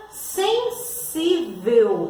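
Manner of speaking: "sensível" very slow, sen-sí-vel, both S sounds clear; "sensível" sounded out slowly for the class; syllable by syllable. Shown as a demonstration. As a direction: break "sensível" into long syllables. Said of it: "tem o seu som original".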